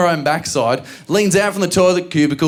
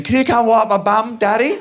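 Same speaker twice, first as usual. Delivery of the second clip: put-on child voice; 6 semitones higher than usual. Voicing the small daughter.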